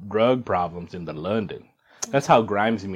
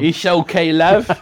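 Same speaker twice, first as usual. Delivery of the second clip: hammed-up cockney, a bit louder; mock London accent; 5 semitones higher, 5 dB louder than usual. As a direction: play a London geezer. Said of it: "You gotta say it in an accent".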